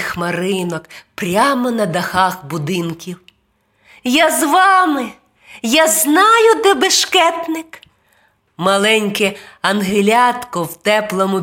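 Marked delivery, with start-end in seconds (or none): 4.0-7.8 high, eager tiny-angel voice; high-pitched, excited angel child; little angel. A narrator's reading. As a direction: pipe up as a small helper. Little angel joins the heroes.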